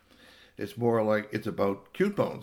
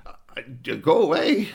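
frightened; 5 semitones higher, 9 dB louder than usual. Acted. Scared cat faces rodent.

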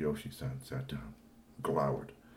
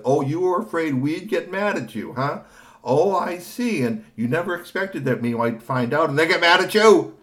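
sarcastic reproach; 11 semitones higher, 12 dB louder than usual. Acted. Professor mocks the boy.

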